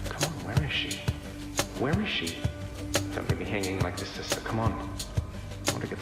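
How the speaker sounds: hushed grumbling to oneself; impatient muttering. Acted. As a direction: mutter it softly while waiting for someone late. Alone, waiting impatiently for sister.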